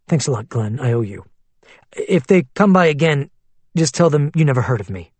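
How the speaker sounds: ordinary delivery, nothing marked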